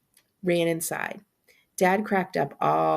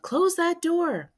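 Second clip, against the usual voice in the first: high, drawn-out holler; mild high-pitched yell; yelled order; 12 semitones higher, slower than usual. A narrator's reading. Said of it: "and Joey all yelled".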